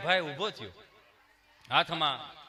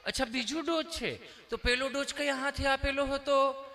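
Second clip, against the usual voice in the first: lightly voiced gentle nurse, higher; sweet nurse; 5 semitones higher, 4 dB quieter than usual. Nurse gently asking the patient which dose. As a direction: ask kindly like a polite nurse. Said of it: "nurse બેને".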